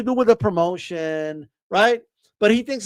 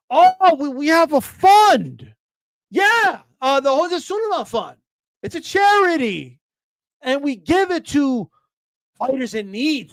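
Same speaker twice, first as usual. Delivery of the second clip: loud, high mocking of officials' excuses; mock excuse-making; 4 semitones higher, 4 dB louder, slower than usual. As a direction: parody a pompous justification, stretching the key words. Mocking sanctioning bodies' excuses.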